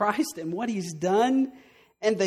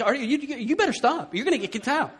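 irritated warning; 6 semitones higher, 6 dB louder than usual. Retold or imitated. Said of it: "you start getting irritated with them".

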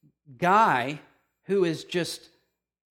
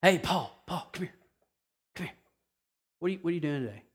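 meek pleading Jesus; 5 dB quieter, much faster than usual. Pretend. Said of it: "Here's how we would put it".

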